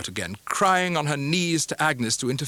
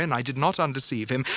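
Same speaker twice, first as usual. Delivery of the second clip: ordinary delivery, nothing marked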